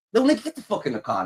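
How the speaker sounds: usual speaking voice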